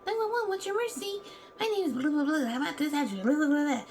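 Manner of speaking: mock 911 call. Acting out a call to 911, high quick mock. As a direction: play the hurried emergency call.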